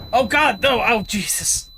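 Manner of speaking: high, loud yelp of alarm; panicked outcry. Real, not acted. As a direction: cry out as it blows up on you. Game keeps exploding on player.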